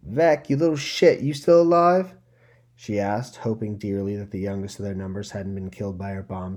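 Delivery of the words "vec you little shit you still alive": loud, high gang-boss call into the dark; gruff shout. Acted. Lyria calling out to Vec after the blast, loud and gruff. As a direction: yell it down the tunnel to find her.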